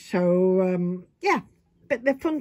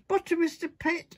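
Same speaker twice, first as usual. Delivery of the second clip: calling out; 8 semitones higher than usual. Retold or imitated. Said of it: "It's quite loud".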